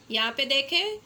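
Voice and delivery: ordinary talk, nothing marked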